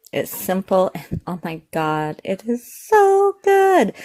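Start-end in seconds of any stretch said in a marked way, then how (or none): 2.2-3.9 high, loud, slow gush; excited. Real, not acted. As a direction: rave about the taste, squealing. Raving about the food.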